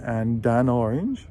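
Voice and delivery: "and an orange" run together, D into "an", N into "orange"; one smooth "and an orange"; linked and an orange. Shown as a demonstration. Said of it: "joins the A, A of an".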